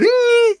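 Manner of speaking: very high "ee" noise; high squeal. Retold or imitated. Comic imitating the noise in the dog story, high squeal.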